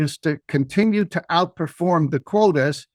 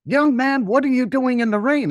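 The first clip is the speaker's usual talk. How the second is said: lady calling out; 8 semitones higher than usual. Retold or imitated. A kind lady calling to a boy selling flowers in the rain, higher voice. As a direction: call over to the kid like a concerned older woman.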